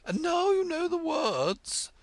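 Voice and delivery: high, whimpering queen voice; drowsy queen. Acted. Queen nestling on Alice's shoulder, high whimper. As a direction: whine it like a sleepy old queen. Queen sleepily, crying sound.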